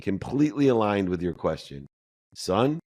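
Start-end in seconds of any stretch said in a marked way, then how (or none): 2.3-2.8 raised, gentle address; a mother calling her son gently; calm mom. Retold or imitated. Mother gently addressing her son, a bit higher. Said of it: "my mom said to me".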